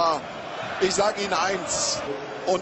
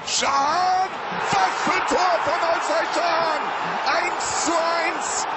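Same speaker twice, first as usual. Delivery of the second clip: ecstatic goal call; 10 semitones higher than usual. Real, not acted. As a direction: scream the goal call in delight. Goal scored, commentator erupts.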